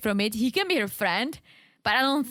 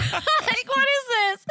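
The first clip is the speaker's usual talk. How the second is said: laughing, much higher; cracking up in disbelief; real laughter through the words; 14 semitones higher than usual. Real, not acted.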